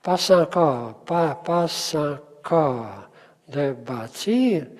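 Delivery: slow, drawn-out "encore" and "bâtir", weight on their endings; stress on last syllable. Shown as a demonstration. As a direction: separate the syllables, landing on "-core" and "-tir". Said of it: "l'accent est là". (accent appuyé sur la fin de « encore » et « bâtir », lentement)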